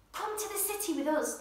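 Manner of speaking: inviting voice. Acted. Girl and father invite the bear to the city, lightly voiced. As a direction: coax him along, lightly voiced. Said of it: "they said".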